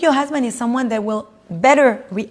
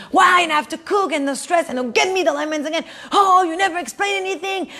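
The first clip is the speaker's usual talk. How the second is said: high-pitched complaining wife, a touch mocking; wife scolds husband over the lemons, exasperated and higher; upset wife; 6 semitones higher than usual. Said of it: "she got really upset at him".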